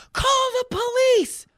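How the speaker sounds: very high, slow hammed-up wail; mock screaming. Whole restaurant staring at him, mock screaming. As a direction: stretch it out in a high mock shriek. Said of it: "at this man screaming".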